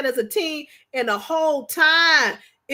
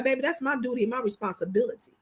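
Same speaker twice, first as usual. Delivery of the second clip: quieter, fast, lower loving reassurance; soft husband quote; 4 semitones lower, 7 dB quieter, faster than usual. Retold or imitated. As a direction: murmur it like a devoted husband. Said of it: "He be like".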